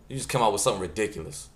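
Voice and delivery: normal talking voice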